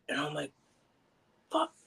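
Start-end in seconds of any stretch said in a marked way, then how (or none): none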